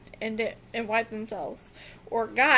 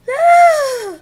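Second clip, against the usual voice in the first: loud high startled yelp; sudden yelp; 16 dB louder than usual. Real, not acted.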